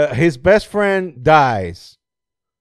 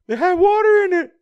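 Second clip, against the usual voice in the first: much higher, louder cartoonish whine; mock high movie voice; 4 dB louder than usual. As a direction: squeak it out like a dumb hero. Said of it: "I was like".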